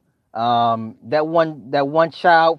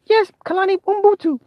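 put-on very high child voice; little girl stating her name, very high. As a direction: answer brightly like a little girl.

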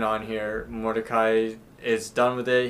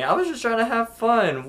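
high-pitched imitated complaint; protesting; 11 semitones higher than usual. Rigby explaining himself to a bouncer, high protest. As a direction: plead your case in a raised voice.

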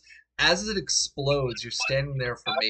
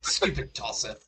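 mock British accent. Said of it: "I can't British".

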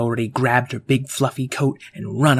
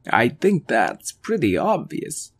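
a gently downcast alien; Chris alien voice. Acted.

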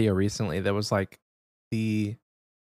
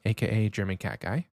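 normal talking voice

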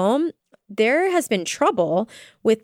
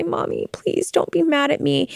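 daughter's plea; 7 semitones higher than usual. Retold or imitated. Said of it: "come to me and say".

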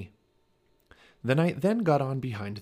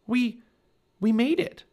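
high, halting, shivery voice; higher voice, broken by shivers; teeth chattering; 12 semitones higher, 5 dB louder than usual. A narrator's reading. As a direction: stammer it out through chattering teeth. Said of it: "Her teeth were chattering".

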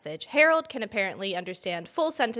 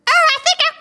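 squeaky much higher voice, cracking into laughter; failed dog voice; 11 dB louder, faster than usual. Pretend. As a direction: tries the dog's voice and it comes out wrong. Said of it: "Oh, that is not her voice".

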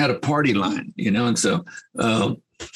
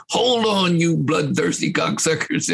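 mock yell; 7 semitones higher, slower than usual. [name] yelling at the crew.

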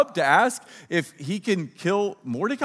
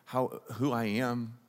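quiet, grumbling mock CEO; mumbled under breath; 4 semitones lower, 5 dB quieter than usual. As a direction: grumble it half to yourself. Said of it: "mumbles under his breath".

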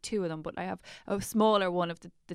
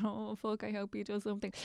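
hushed mutter; 10 dB quieter than usual. Retold or imitated. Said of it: "crazy moms just going".

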